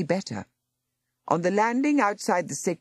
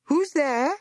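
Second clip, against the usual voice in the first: high, louder demand into the dark; 12 semitones higher, 3 dB louder than usual. Acted. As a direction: call out sharply at the door. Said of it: "Miss Boston demanded".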